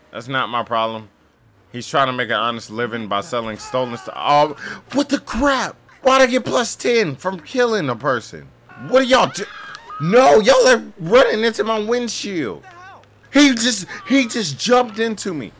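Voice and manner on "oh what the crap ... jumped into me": high, loud, frantic pleading; frantic protest. Real, not acted. Gamer protesting as NPCs run into his car. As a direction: squeal it in disbelief.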